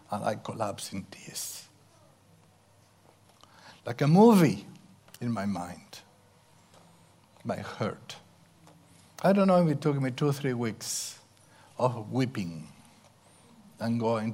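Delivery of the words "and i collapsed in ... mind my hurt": ordinary talk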